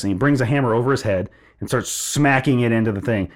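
ordinary talk

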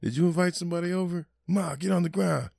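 high, fast alarmed question; mock panic. Panicked relative asking who invited someone over, fast mock alarm. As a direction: scramble as if under attack.

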